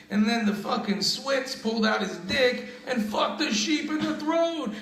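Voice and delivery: high-pitched, building punchline; mock punchline delivery. Comic telling a radio joke's punchline, high and climbing. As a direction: pitch climbs toward the end of the joke.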